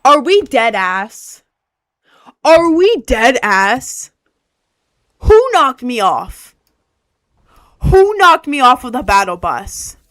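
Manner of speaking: loud, slow, incredulous demand; indignant outburst. Real, not acted. Player knocked off the battle bus, loud indignant demand. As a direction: demand answers, leaning hard on the first words.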